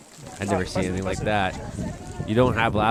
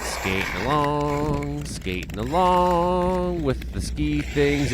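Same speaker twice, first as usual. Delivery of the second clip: slow, high lilting narration; slow sing-song; 5 semitones higher, much slower than usual. Real, not acted. Narrating the skater for viewers, slow and lilting. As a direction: stretch out each word lazily.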